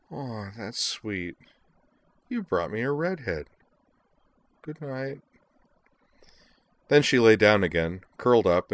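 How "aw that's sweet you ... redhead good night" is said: slow, high, drowsy murmur with a smile; dreamy and sleepy. A narrator's reading. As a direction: drift off dreamily, softly. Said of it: "dreamy smile as she said".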